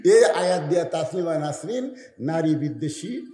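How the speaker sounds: normal talking voice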